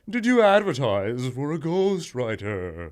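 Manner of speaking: slow, spooky ghost voice. Pretend. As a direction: moan it like a ghost. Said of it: "You gotta read it in your ghost voice".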